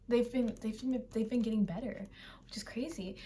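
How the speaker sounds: hushed secret-like whisper; whispered aside. Real, not acted. Fan confiding to viewers, whispering. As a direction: whisper it like a confession. Aside about band improving.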